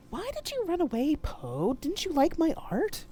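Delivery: high, eerie hurt ghost voice; wounded ghost asking why; ghostly mother. Acted. Mama J's ghost asking Poe why she ran, eerie.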